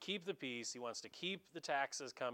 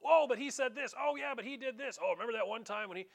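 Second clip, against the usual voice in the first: higher, louder, fast tattling; clamoring accusers; 7 semitones higher, 6 dB louder, faster than usual. Retold or imitated. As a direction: pile on eagerly like accusing priests. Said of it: "you can imagine the others".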